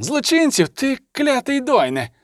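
high-pitched furious outcry; outraged cry. A narrator's reading. Elf officer curses commander over the criminal label, high outraged cry. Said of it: "крикнув Ріордан".

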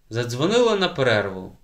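ordinary delivery

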